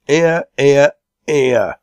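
high, loud cheer of approval; crying out. A narrator's reading. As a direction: applaud with a hearty shout. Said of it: "and cried out".

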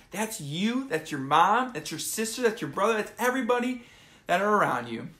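ordinary delivery

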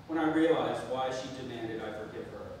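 usual speaking voice

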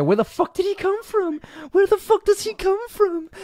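high-pitched, loud squeal of disbelief; incredulous outburst. Real, not acted. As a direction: shriek it, baffled and about to laugh. Amazed by man appearing in clip.

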